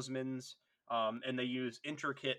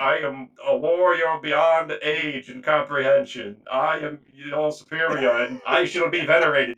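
pompous Dreadnought; 12 dB louder than usual. Retold or imitated. Tiberos in dreadnought armor boasting, grandly booming. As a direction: proclaim it like an ancient war machine.

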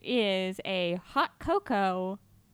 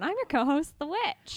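witch voice; 7 semitones higher, 3 dB louder than usual. Acted. Introduces herself as the witch.

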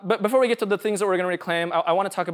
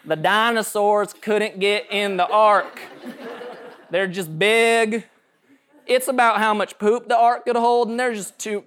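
slow, louder mock Southern accent, laughing through it; 3 semitones higher, 4 dB louder, slower than usual. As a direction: ham up a Southern Bible-teacher accent, drawn out. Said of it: "my southern voice whenever I'm, like, explaining Noah's Ark".

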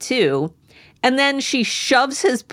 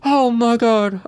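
higher, laughed-out exclamation; laughing relief; 4 semitones higher than usual. Real, not acted. Co-host recovering from a giggle fit, still laughing. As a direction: groan it through the last giggles.

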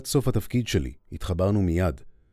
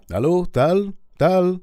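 raised voice calling into a dead line; 9 semitones higher, 4 dB louder, much slower than usual. Retold or imitated. Narrator yelling after the call cuts off, raised and high. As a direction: call his name into the phone, higher and louder. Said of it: "צעקתי".

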